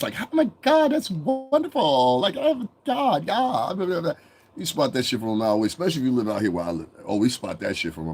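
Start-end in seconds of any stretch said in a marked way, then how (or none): 0.2-4.1 high-pitched, drawn-out mock gushing; fake voice. Mocking newcomers who act for whites, in a high fake voice. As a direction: put on a fake, over-the-top high voice. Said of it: "you got the fake voice".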